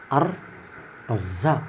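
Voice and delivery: Ar-Razzaq said very slowly and softly; drawn-out recitation. Shown as a demonstration. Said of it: "Cara bacanya begitu". (Ar-Razzaq dibaca sangat pelan dan lembut)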